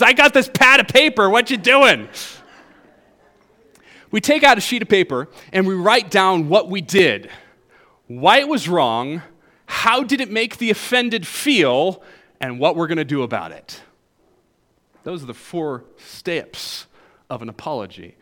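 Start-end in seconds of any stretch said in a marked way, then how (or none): 0.0-2.1 higher, louder mock teen voice; imitating Brooke. Preacher imitating Brooke showing off her pad, higher and louder. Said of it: "and Brooke says". 15.0-18.2 southern accent. Real, not acted. Preacher's Southern accent slipping out on "four steps". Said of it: "my southern accent just came out there".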